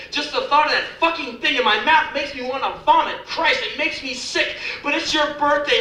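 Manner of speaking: high-pitched exasperated woman's tirade; acted fed-up outburst, voice held high; disgusted grudging rant. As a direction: rant in revulsion, pitched well up.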